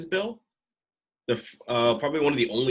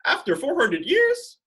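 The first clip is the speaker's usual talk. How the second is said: excited; 10 semitones higher, 5 dB louder than usual. Real, not acted. Excited about reparations check.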